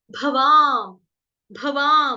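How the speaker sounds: भवाम with a loud, lengthened वा; long aa. Shown as a demonstration. Teacher points out the vowel sign, loud long वा in भवाम. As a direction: lean hard on the long aa in भवाम. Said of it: "आ की मात्रा".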